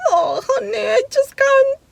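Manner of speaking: high sobbing plea; breaking down in tears, voice cracking high; crying to husband. Retold or imitated. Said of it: "I was like".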